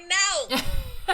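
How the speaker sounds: high, loud comic no with a laugh; mock refusal. Friend imagining the other turning down her advice, mock refusal. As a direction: playfully refuse point-blank.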